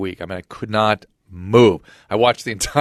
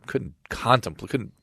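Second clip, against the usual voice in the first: ordinary talk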